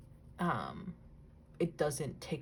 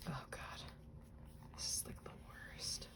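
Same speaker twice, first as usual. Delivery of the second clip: unvoiced, much softer, whispered; 5 semitones lower, 14 dB quieter than usual. Real, not acted. Reacting to a photo while working, whispered. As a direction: mutter it under the breath.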